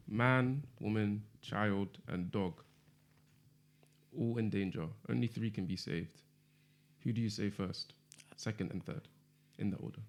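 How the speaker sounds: soft and slow, setting a dilemma; quiet. Real, not acted. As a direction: lay it out quietly like a riddle. Posing a grim scenario.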